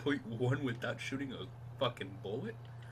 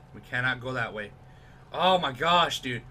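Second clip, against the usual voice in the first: normal talking voice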